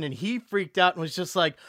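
ordinary talk

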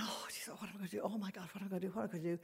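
hushed, frantic whispering to self; acted whisper, trying not to be overheard; panicked whisper. Hiding in the toilet from her mother, panicked whisper.

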